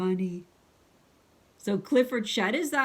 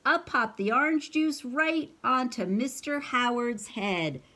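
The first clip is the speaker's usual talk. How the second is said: plain reading voice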